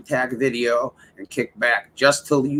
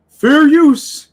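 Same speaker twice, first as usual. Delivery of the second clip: high, loud nasal-free squeal of a whiny streamer; mocking impression; 13 semitones higher, 10 dB louder than usual. Streamer mimicking a banned rival's catchphrase, high and loud. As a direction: shriek it like a caricature. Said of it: "what he used to say besides fair use".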